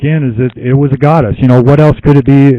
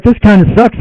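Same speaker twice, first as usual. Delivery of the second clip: high-pitched, half-laughing grumble; playfully let-down whine; mock disappointment; 10 semitones higher than usual. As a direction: complain lightly in a raised voice. Mock letdown at an obscure goddess.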